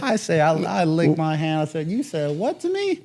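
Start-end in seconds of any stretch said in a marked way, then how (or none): 1.9-3.0 high, slow, incredulous challenge; can't believe what he heard; retold shocked comeback; indignant disbelief.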